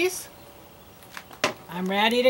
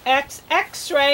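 ordinary delivery

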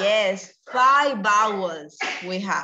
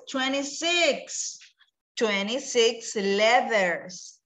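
slow answer; much slower than usual. Shown as a demonstration. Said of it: "teacher gives answer slowly".

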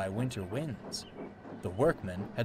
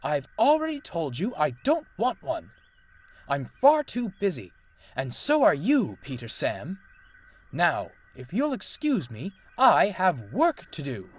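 cross, loud and raised; snapping; 6 semitones higher, 7 dB louder than usual. A narrator's reading. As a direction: irritably brush them off at volume. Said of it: "he snapped".

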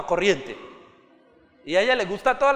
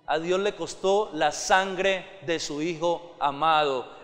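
normal talking voice

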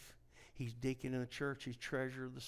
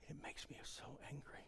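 whispered confession of anger; hushed, intense whisper; 3 semitones higher, 12 dB quieter than usual. Acted. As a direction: drop to a whisper and let them lean in. Confides anger to congregation.

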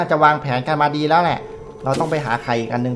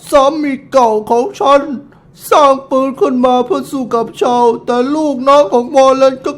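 raised, theatrical middle-aged woman voice; auntie voice; 9 semitones higher, 5 dB louder than usual. Acted. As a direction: play the proud widow auntie, a bit higher. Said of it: "ทำไมเสียงเจ๊เป็นอย่างเงี้ย". (เสียงป้าแบบเล่นละคร สูงขึ้นเล็กน้อย)

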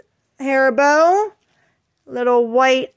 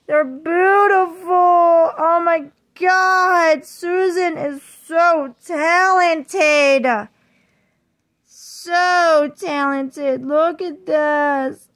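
very slow, stretched-out gushing; awed admiration; 3 semitones higher, much slower than usual. Real, not acted. Vlogger praising Susan's work on the doll, awed admiration. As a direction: drag out each word in wonder.

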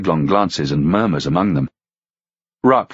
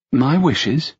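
surprised; 8 semitones higher than usual. Acted. Melanthe surprised.

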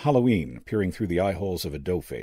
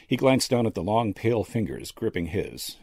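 ordinary delivery

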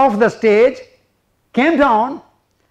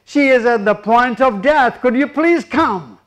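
usual speaking voice

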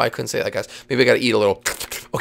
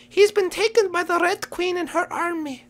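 very high little creature voice; rabbit bearing bad news; 4 dB quieter than usual.

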